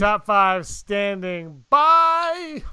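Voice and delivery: slow, loud, drawn-out announcement; announcer voice. Acted. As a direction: call it out like a show segment. Announcing the show segment.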